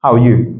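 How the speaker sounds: HOW ARE YOU run together, falling at the end; intonation demo. Shown as a demonstration. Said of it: "Soit on monte ou on redescend". (HOW ARE YOU avec intonation descendante)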